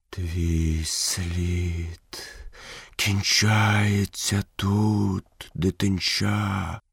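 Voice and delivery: slow, drawn-out python voice; Каа. A narrator's reading. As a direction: stretch every word like a huge old snake. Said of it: "запитав Пітон Каа". (повільний, протяжний голос пітона)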